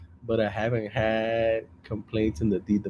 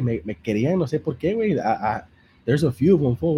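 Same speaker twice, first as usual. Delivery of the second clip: usual speaking voice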